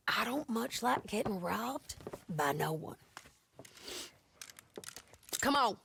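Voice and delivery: ordinary talk, nothing marked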